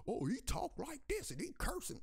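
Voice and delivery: high sneering mock of critics. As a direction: mimic the critics with a whiny sneer. Mocking critics of his speech.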